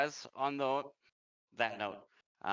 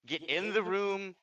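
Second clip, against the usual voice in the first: high, raised-voice command; acted yell; 10 semitones higher, 4 dB louder than usual. Game master voices a player ordering the party inside, yelling. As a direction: call it out urgently, pitched well up. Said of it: "you hear him yell".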